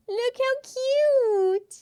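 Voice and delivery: drawn-out high cutesy voice; baby-talk coo. Shown as a demonstration. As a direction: squeal it like cooing at a baby. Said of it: "repeated in baby voice".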